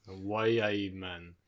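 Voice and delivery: low, quiet, drawn Geordie copy; unsure imitation of a Newcastle accent; subdued amateur Geordie; attempted Geordie. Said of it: "[name], you have to do it".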